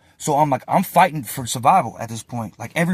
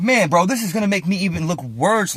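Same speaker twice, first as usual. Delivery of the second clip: higher-pitched retold complaint to the officer; exasperated protest; 6 semitones higher, 3 dB louder than usual. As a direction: protest in a raised, pleading pitch. Acts out protest at being moved.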